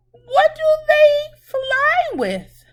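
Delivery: high, fast, eager quiz question; excited question. Acted. Teacher quizzing children on the pet, high and eager.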